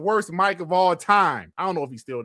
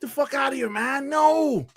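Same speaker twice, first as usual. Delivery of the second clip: higher put-on voice; a light take on D-batch; lightly pitched-up dismissive voice; D-batch impression; 10 semitones higher than usual. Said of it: "Probably my D-batch".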